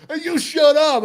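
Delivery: loud, high yell back at a heckler; mock-indignant shouted retort; retold comeback yell. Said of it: "And I'm like".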